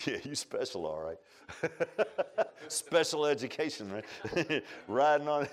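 chuckling through the joke; laughing mid-sermon. Real, not acted. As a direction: laugh along with the crowd.

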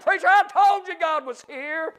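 high-pitched revival preaching cry; exultant proclamation. Real, not acted. Preacher repeating old brother's words to church, exultant cry.

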